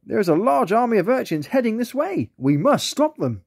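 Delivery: high otter voice, slowing at the end; rally them to stop the urchins; urgent otter. A narrator's reading. Otter urging friends to stop the urchin army, high and urgent.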